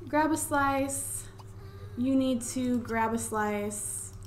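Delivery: slow, drawn-out coaxing; slow coaxing nudge; slow urging. Real, not acted. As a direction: coax the Sim slowly to eat. Urging Sim to eat.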